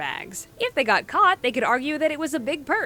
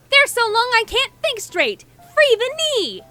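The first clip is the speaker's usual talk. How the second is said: much higher, loud mock protest chant; whiny schoolgirl; 12 semitones higher, 7 dB louder than usual. Narrator mocking schoolmates with a loud, high protest. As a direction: play the complaining girls, loud and high. Girls complaining about long skirts.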